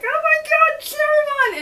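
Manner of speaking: high, drawn-out squeal of delight; fangirl squeal. Retold or imitated. Fan gushing over her ship, squealing high and slow. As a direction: squeal the name like a thrilled fan. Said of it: "I have my moments where I'm like".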